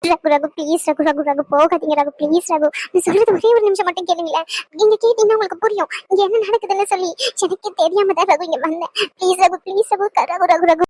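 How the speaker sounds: low, pleading through tears; repeated tearful begging. Acted. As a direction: beg him not to leave, near crying. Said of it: "தயவு செஞ்சு அழுகாத".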